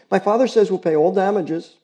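put-on pleading girl. Girl begging the neighbors not to report her dog, urgent girlish plea. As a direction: beg them to spare the dog.

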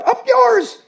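shrill squawk, very high and loud; bird cry. Acted. Birds squawking insults from the trees, very high and loud. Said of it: "the birds cried".

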